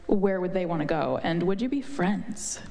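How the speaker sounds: usual speaking voice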